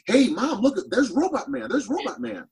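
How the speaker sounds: put-on high-pitched child calling out to Mom; little kid. Little kid spots a stranger in the grocery store and tells Mom, high imitated child voice. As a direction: imitate a kid excitedly pointing something out, voice way up. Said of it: "some little kid is at the end of the aisle pointing and saying".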